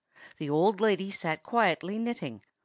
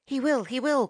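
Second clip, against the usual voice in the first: higher, urgent promise; crying out; 7 semitones higher than usual. A narrator's reading. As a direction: plead quickly to soothe the parrot. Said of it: "cried Toto".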